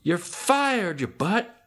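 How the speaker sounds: high, drawn-out mock scream; imitated scream. Princess screaming to Lemongrab from the window, mock high yell. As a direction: yell it slowly, way up high, as an impression. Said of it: "and screams to Lemongrab".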